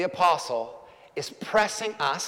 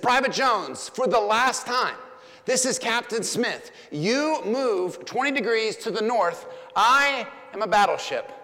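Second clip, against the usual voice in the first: higher, insistent captain's final command; captain giving the private a final stern order; even more stern; 4 semitones higher than usual. Retold or imitated. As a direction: bark it as a last warning, not quite shouting. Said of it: "And now with even more sternness".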